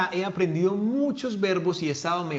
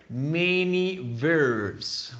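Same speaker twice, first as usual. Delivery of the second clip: "many verbs" drawn out very slowly; very slow model; much slower than usual. Shown as a demonstration. Teacher modelling the phrase slowly for the class. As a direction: stretch each word for students to copy. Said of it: "we say here".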